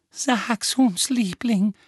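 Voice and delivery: high, coaxing aunt voice; flüsternde Tante. A narrator's reading. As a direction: coax a darling son, pitched high. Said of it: "flüsterte Tante Petunia". (hohe, bittende Tantenstimme, nicht tonlos)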